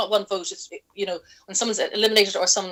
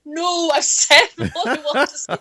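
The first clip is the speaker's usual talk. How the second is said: laughing refusal; 5 semitones higher, 6 dB louder than usual. Retold or imitated.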